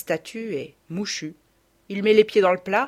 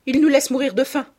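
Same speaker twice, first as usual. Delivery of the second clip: louder, higher insistent; loud repeating; 7 semitones higher, 6 dB louder than usual. A narrator's reading. Mother accuses father before everyone, loud insistent voice. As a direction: hammer it home. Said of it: "répète ma mère".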